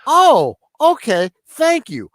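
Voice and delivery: high, surprised imitation of the wife; surprised realization. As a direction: retell a sudden, polite "oh!" moment. Acting out wife's surprised realization.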